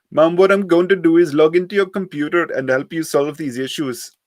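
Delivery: put-on high, loud scam-caller accent; heavy Indian accent. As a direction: play a pushy call-centre scammer. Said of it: "heavily accented voice".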